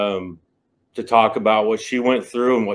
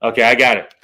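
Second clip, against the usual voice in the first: ordinary talk, nothing marked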